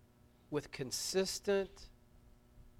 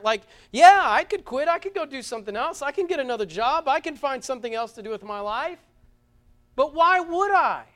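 louder, higher, building preacher's delivery; impassioned preaching; 6 semitones higher, 8 dB louder than usual. Real, not acted. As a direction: preach it with rising, emphatic force. Preaches at full force.

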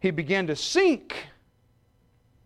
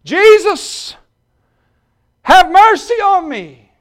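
very loud, much higher cry for mercy; desperate shout; 16 dB louder, much slower than usual. Acted. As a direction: scream it across the crowd. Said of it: "He shouted".

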